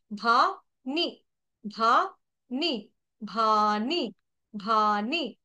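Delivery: भानी with a breathy, aspirated भ, slowly; भानी with भ as in भालू. Shown as a demonstration. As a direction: push air on the भ of भानी. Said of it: "भ भालू का".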